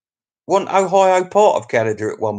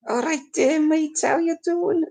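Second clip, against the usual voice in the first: lightly put-on Scouse accent, much higher; mock Scouse greeting; 14 semitones higher than usual. Vlogger greeting a Liverpool viewer in a high mock Scouse accent.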